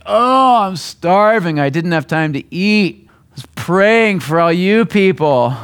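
self-pitying churchgoer angling for praise, hammed-up; showy martyr complaint. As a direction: ham up the hungry martyr for sympathy. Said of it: "walk into, you know, to home church and like".

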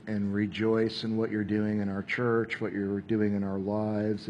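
soft, quiet prayer voice; closing prayer. Acted. Pastor to God for the church, soft prayer. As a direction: pray gently over the group.

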